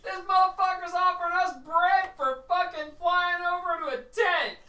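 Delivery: high, loud indignant squawk; squawking cardinal tirade; ranting bird. Acted. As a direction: rant like an outraged little bird.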